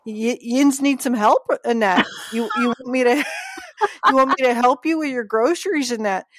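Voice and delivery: sweet neighbor offering help in Pittsburgh dialect; cheerful Pittsburgh accent, a bit fast. Retold or imitated. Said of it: "they'll say in a cute little accent".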